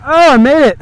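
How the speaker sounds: high, breathless, elated; shaky excitement after a close call; thrilled relief. Real, not acted. Relief after dangerous crossing.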